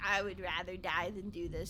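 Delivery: low, soft, fed-up grumble; low and unenthused; mock misery. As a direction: act like you'd hate every second. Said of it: "I'd be like".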